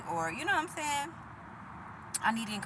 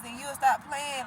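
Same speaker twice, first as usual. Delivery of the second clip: normal talking voice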